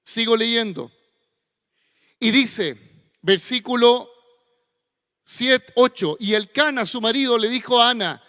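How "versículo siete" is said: ordinary talk, nothing marked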